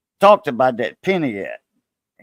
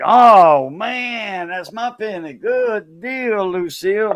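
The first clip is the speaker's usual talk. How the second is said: retold excitement; 6 semitones higher, much slower than usual. Buyer learning the best penny is his, re-enacted excitement. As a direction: re-enact being thrilled at a win. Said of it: "Man, I was all excited".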